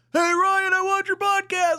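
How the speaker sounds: very high, thin imitated distant yell; calling from afar. Fan in traffic spots the host, imitated far-off call. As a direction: mimic a fan hollering from way off. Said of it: "further away".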